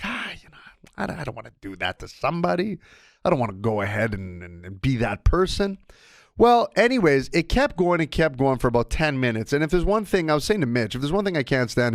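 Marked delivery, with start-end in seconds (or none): 0.0-5.8 a mild, softer hangdog voice; mock dejected. Host retells feeling dejected by neighbor, mildly downcast. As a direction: sound a bit deflated about it. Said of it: "I'm like".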